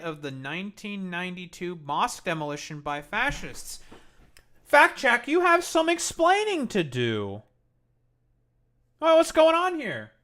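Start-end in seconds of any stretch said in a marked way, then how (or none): 4.6-7.4 high, loud put-on voice calling the chatter out; mock stern summons. Streamer calls out chatter Fact check, high mock-stern voice. 9.0-10.1 high, loud put-on nosy voice; mock suspicious. Streamer teasing a chatter, high mock-suspicious voice.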